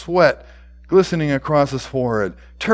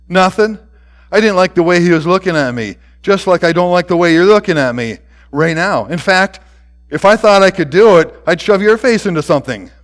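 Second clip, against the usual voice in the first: loud, higher-pitched angry retort; defiant boy; 4 semitones higher, 7 dB louder, faster than usual. A narrator's reading. Boy threatens the principal who questions him, defiant. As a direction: talk back as a belligerent schoolboy, fast in bursts.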